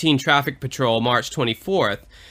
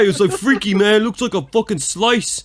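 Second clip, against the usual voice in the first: brash Philly-New York street guy; New York accent; 6 semitones higher than usual. Pretend. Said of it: "all that New York shit we just did".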